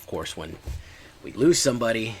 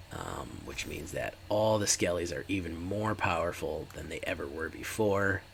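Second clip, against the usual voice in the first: usual speaking voice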